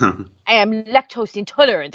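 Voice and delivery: imitated, badly faked French accent; crappy French accent. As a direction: put the bad French accent back on. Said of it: "her crappy French accent".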